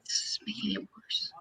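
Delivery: whispered aside; muttered under the breath; whispering. Real, not acted. Unvoiced whisper to self.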